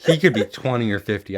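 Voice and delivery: talking through laughter; laughing. Real, not acted. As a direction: laugh while saying it. Laughing about Ozpin's age.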